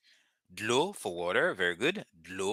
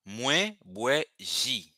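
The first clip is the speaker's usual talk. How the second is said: very slow, higher-pitched, word by word; slow teaching model; 4 semitones higher, much slower than usual. Shown as a demonstration. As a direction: stretch each word for learners to copy. Teacher models a Creole sentence.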